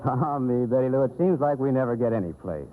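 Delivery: ordinary delivery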